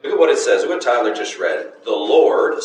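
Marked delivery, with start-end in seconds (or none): 1.8-2.6 "Lord" leaned on, louder, with a rise; stress on Lord. Shown as a demonstration. Teacher stressing "Lord" to show it is singular. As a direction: point out the word "Lord" to the class.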